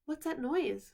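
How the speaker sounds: a startled child asks, lightly voiced; Lucy suddenly hears a noise, mildly startled; sudden alarm. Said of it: "said Lucy suddenly".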